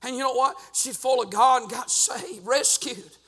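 high, loud revival preacher cadence; full-force preaching. Real, not acted.

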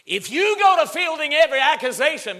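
loud, high preacher's outburst; emphatic warning. Real, not acted. Preacher on answering every accusation, loud high warning. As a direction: burst out with the warning.

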